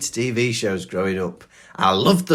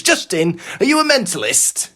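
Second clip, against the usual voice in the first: mock scolding mother, much higher and louder; exasperated mum; 7 semitones higher, 4 dB louder than usual. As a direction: imitate the McDonald's mum telling off her son. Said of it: "She goes".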